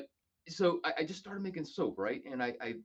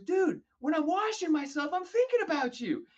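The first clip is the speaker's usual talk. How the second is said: Keith's punchline; 5 dB louder than usual. Retold or imitated. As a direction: deliver the punchline hammed up.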